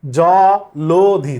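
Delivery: জলধি said slowly as "জলোধি", the ল with an o sound; correct pronunciation. Shown as a demonstration. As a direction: stretch জলধি out syllable by syllable, জ-লো-ধি. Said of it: "এই অটার উচ্চারণ হচ্ছে ও এর মতো হবে". (ধীরে ধীরে জলধি, ল-এ ও-কার দিয়ে)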